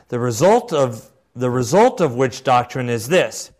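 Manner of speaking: measured, emphatic oratory; formal quotation reading. Acted. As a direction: read it like a solemn proclamation. Reads bishop's words aloud.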